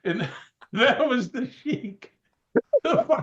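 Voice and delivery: can't get it out for laughing. Real, not acted. Cracking up at own story.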